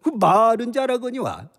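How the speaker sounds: slurred mocking drunk general. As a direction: sneer at rivals while tipsy. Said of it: "술 취한 연기가 디테일해요".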